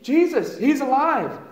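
high ringing cry of wonder; excited announcement. Retold or imitated. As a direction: announce it breathless with joy. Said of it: "she's like".

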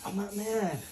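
usual speaking voice

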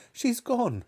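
high, distraught cry. A narrator's reading. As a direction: cry the news in dismay. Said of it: "cried Missus Cranky".

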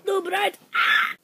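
high, loud startled yell; sudden shout. Real, not acted. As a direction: cry out suddenly.

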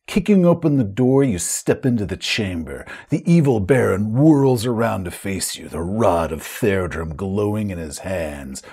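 dramatic scene-setting, sinking low at the end; game master narration. Acted. Game master describes the chamber to players, dramatic narration. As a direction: narrate the villain's entrance, slowing as it closes.

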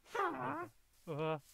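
sudden high yelp; panicked cry for help. Real, not acted. Attacked in game, crying out.

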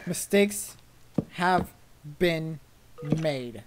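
"mistakes... have... been... made", a pause after each word; word-by-word emphasis. Real, not acted. Player summing up the blunder, "mistakes have been made" word by word. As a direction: step down in pitch from "mistakes" to "made". Same words, one at a time.

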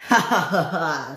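acted "ha ha" cackle; villain laugh. Diesel pleased with his plan, acted villain laugh.

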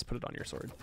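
playful whisper to the audience; whispered aside. Pretend. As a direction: lean in and whisper the secret. Conspiratorial aside to viewers.

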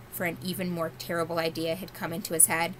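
ordinary delivery, nothing marked

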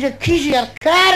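a hint of a scandalised constable; incredulous policeman.

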